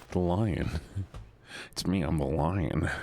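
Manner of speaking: low, proud lion declaration; grave and deep. Pretend. Claims to be the lion.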